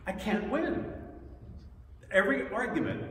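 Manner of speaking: high-pitched comic complaint; mild exasperation. Retold or imitated. Speaker losing every argument with God, mock exasperated.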